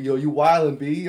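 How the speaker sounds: loud Brooklyn yo; Brooklyn accent. Pretend. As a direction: tough Brooklyn girl slang. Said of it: "that's how girls are talking".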